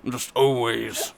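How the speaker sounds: low, slow villain voice; Illidan. Shown as a demonstration. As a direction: drop deep and drag it out like an evil mastermind. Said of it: "whenever I need a villain".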